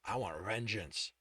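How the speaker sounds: low, slow menacing growl; low growl of vengeance. Acted. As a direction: growl the demand. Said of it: "Alex growled".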